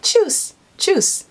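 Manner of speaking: very high, sung-out Tschüss; Tschüss in a very high, singing voice; high-pitched sing-song. Shown as a demonstration. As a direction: sing it high like a cheerful goodbye. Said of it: "that's how I would say it".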